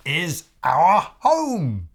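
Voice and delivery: slow, tearful and loud; a bird proudly near tears; touched, teary. Acted. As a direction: say it with emotion. Bird shows their home.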